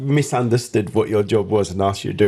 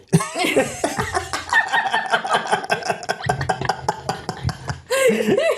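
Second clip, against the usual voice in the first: long helpless laugh. Real, not acted. Host bursts out laughing.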